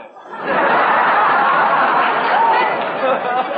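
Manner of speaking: loud long burst of laughter; hearty laugh. Real, not acted. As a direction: crack up at his own pun. Laughing at own joke.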